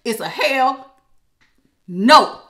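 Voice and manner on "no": much louder no breaking into laughter; laughing. Real, not acted. Reader laughing at Aquarius refusing the ex. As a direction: crack up on the no. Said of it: "I didn't mean to laugh".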